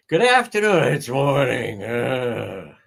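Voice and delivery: groggy put-on voice; groggy imitation; mock hungover witness. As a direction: play her confused and foggy.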